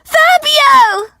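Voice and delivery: very high-pitched squeal of a name; high, thrilled call; excited fan shout. A narrator's reading. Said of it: "I start to shout".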